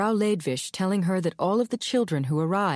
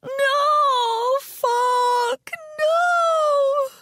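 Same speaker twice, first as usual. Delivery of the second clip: much higher, crying wail; crying moan; 5 dB louder, much slower than usual. Acted. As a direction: wail through tears. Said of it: "she moaned".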